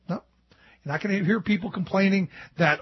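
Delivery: ordinary talk, nothing marked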